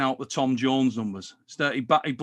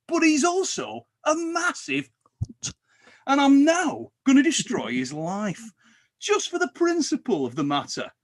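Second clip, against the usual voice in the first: mimicking Pradeep; 4 semitones higher than usual. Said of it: "the Pradeep approach where he goes".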